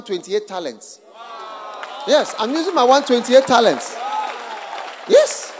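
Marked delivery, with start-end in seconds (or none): none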